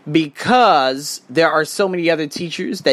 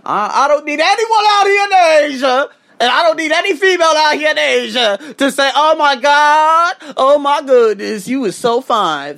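mock fawning woman; 11 semitones higher, 6 dB louder than usual. Mocking girls swooning over him in a high voice. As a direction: gush like a fawning admirer.

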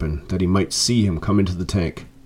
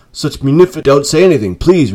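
high, loud and insistent; effusive interruption; 6 semitones higher, 6 dB louder than usual. A narrator's reading. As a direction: break in eagerly on the thanks. Effusive thanks cut short by Scrooge.

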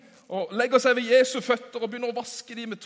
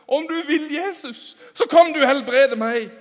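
higher, louder desperate plea; pleading leper; 6 semitones higher, 10 dB louder than usual. Acted. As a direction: throw yourself down and beg to be healed. Leper begging at Jesus' feet. (spedalsk mann som trygler Jesus om helbredelse, høyt og bønnfallende)

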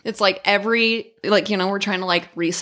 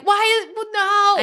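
much higher, flustered backpedal; flustered parent; 12 semitones higher, slower than usual. Retold or imitated. Mother caught out by her crying kids, high flustered stammer. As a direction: catch yourself mid-sentence, pitched up.

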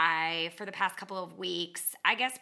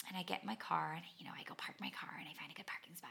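very soft unvoiced whisper; 3 semitones lower, 14 dB quieter, faster than usual. Real, not acted. Parked in the car, soothing herself in a whisper. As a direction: whisper to calm yourself.

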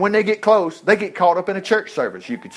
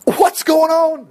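high, slow, loud disbelief; incredulous soldiers; 10 semitones higher, 5 dB louder, much slower than usual. Retold or imitated. Soldiers seeing Saul prophesy, stunned and incredulous. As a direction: gasp out the question in disbelief. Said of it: "They're going".

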